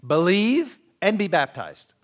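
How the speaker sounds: Believe and be baptized, drawn out and stressed; stressed slow scripture; slow emphatic quote. Acted. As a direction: lean on each word slowly.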